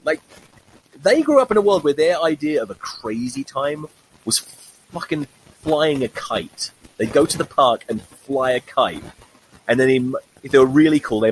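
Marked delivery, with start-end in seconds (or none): none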